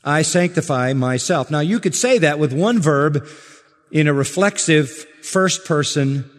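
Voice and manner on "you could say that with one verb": ordinary talk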